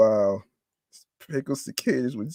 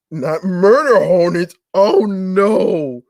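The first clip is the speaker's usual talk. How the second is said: higher and louder, stressing murder; horrified disbelief; 6 semitones higher, 5 dB louder than usual. Real, not acted. Vlogger recoiling at murder hornets on the menu, horrified. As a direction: plead in mock horror.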